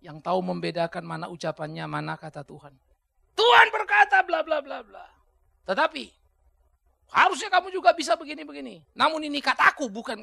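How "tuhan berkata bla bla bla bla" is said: slow, loud, higher mocking of a preacher's claim; mock loud quote. Preacher mocking people who claim God's word, loud and slow. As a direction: boom it out as a parody of a divine decree. Preacher mocks someone citing God.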